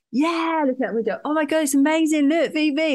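high, gushing mock delight; imitated excitement. Partner at the seaplane window, mock excited. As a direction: urge them to look, hammed-up thrill.

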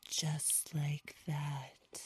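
very slow seductive whisper; ASMR whisper. Pretend. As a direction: linger on every word. Parody ASMR.

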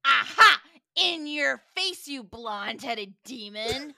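loud, slow taunt, easing off by the end; gloating scream. A narrator's reading. Fred celebrating his win over Sam, gloating scream. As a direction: crow it in your rival's face. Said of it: "screams at Sam".

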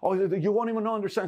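high, gushing, starstruck babble; gushing fan. Retold or imitated. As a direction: gush over your hero like a fan.

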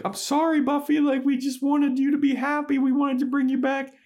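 very high, small apologetic voice; apologetic Willow. Retold or imitated. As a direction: squeak out a timid sorry. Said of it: "and Willow's like".

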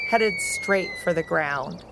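ordinary delivery, nothing marked

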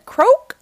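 high, loud croak; toad croak. A narrator's reading. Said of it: "Toad said".

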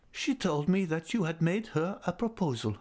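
put-on high, tender old lady's voice; fond old lady. A narrator's reading. Old lady invites Raoul near, voice high and fond. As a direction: coax him close like a loving old aunt.

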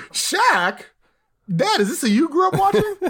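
put-on high whiny kid asking dad. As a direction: pitch way up like a confused kid.

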